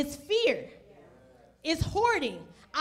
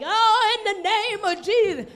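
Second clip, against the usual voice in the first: higher, louder hammed-up preacher cry; mock fervent preacher; 5 semitones higher, 6 dB louder than usual. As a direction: send up the over-the-top prayer shout. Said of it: "he didn't even do a whole lot like we do".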